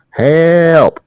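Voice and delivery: high, drawn-out mock "Help"; slow shout. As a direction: stretch out the cry so passers-by hear it. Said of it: "nervous that I'm gonna m-, mumble".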